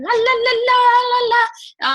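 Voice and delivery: high, loud, babbling little creature; gremlin impression. Imitating little gremlins running around, high mock gremlin babble. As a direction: squeal a silly la-la chant like a tiny creature. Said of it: "little gremlins that, like, run around going".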